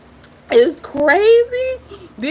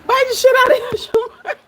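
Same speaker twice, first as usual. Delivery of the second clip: higher pitch, words breaking into laughs; real laughter; 6 semitones higher than usual. Real, not acted. Viewer cheers Shamar's bite at the screen, laughing hard. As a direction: egg him on while cracking up.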